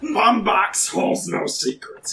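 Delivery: slow, drawn-out character voice; captain's character voice. Acted. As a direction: play the captain, stretching the words. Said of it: "let me try and do this voice".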